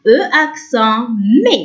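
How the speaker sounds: ordinary talk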